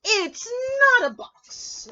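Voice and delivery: high, loud rabbit insisting; excited rabbit voice. Acted. Rabbit answers.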